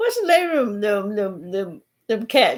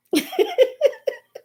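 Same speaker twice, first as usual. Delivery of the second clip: high squealing laugh. Real, not acted. Laughing at the joke.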